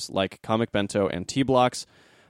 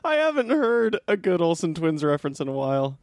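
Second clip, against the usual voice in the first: higher, laughing through the words; helpless laughter; 8 semitones higher than usual. Real, not acted. Host tickled by an Olsen Twins reference, cracking up. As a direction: talk through real tearful laughter.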